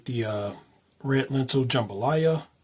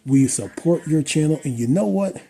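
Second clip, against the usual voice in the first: hammed-up gushing ad read for a fellow YouTuber; mock sponsor ad read; 5 dB louder than usual. Vlogger thanks a fellow creator in a mock sponsor read, gushing. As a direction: sell it like a cheesy fake sponsorship.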